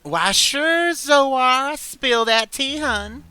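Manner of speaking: high, sassy drag queen voice; Dahlia voice. Acted.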